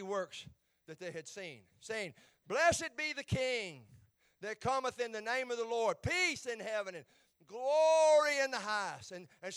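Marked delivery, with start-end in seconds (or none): 2.5-9.1 louder, slow, ringing declaration; loud praise. A narrator's reading. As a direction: proclaim it like a crowd praising. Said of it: "praise God with a loud voice".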